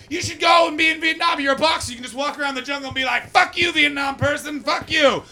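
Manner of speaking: high, loud macho yelling; hammed-up high warmonger shout; gung-ho mock shout. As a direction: ham up the pushy patriots. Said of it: "everyone was like".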